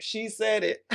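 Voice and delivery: giggling through it into a high laugh; amused, delighted chuckle. Real, not acted. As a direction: laugh at your own boldness. Laughing at her own line.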